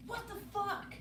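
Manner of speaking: high, sharp what-the-fuck outburst; mock outraged shriek. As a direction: squeal it like an outraged girlfriend. Mock outburst of angry girlfriend.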